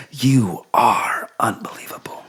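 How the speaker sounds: slow, breathy pillow-talk whisper. Acted. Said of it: "the Pillow Talk voice".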